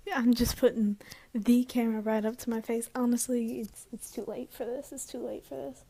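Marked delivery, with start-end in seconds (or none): none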